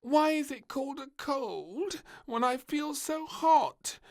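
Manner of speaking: put-on very high child voice; a small girl asking a cheeky question; puzzled little princess. A narrator's reading. Princess quizzes the doctor from her sickbed, high and puzzled.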